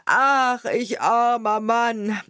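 loud, high, stretched-out cry; wailing lament. A narrator's reading. Carter seeing all his wine run out, loud lament. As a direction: wail it in self-pity, drawing out the words. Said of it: "rief er wieder".

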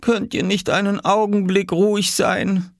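high, groaned plea; moaning mother. Acted. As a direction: carry the groan through a weary request. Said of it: "stöhnte Mama".

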